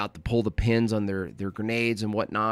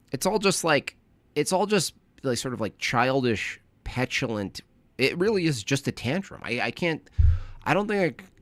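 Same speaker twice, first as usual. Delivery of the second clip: ordinary talk, nothing marked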